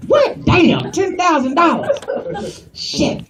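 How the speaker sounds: high, shocked yelp; high-pitched acted outrage; shocked customer. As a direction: squeal in disbelief at the bill. Customer shocked at his bill.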